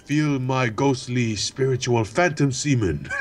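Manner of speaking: low, slow, solemn phantom voice; mock ghost. Player plays a ghost cursing a friend, mock-solemn low voice. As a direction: intone it deep and slow like a spirit.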